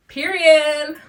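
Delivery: sassy emphatic period. Retold or imitated. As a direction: snap it out with attitude. Sassy one-word retort.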